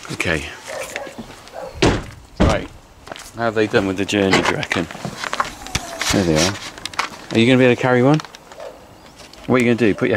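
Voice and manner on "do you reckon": ordinary talk, nothing marked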